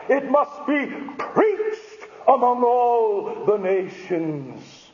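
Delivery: slow, high, ringing proclamation; proclaiming preacher. Real, not acted. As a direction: herald each phrase slowly from the pulpit. Preaches at full force.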